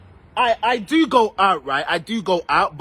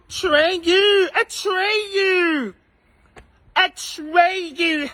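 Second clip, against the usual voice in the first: high teasing sing-song; 9 semitones higher, much slower than usual. Pretend. High teasing calls to viewer.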